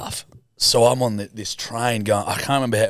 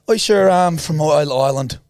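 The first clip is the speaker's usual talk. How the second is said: a panicky put-on Irish accent, louder; faltering Irish accent; 5 semitones higher, 5 dB louder than usual. Teen panics keeping up his fake Irish to the trolley lady. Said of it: "losing a bit of the act".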